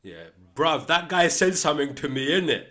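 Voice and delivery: high, loud mock street kid; young roadman voice. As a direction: play a teenager mouthing off, bruv and innit. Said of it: "when you're younger".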